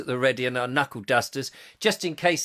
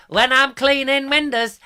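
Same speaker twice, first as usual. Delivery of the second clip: sung high and loud in comic style; hammed-up music-hall singing impression; 9 semitones higher, 6 dB louder than usual. Host doing an old film comedian's song, high singing voice. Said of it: "the old [name] films".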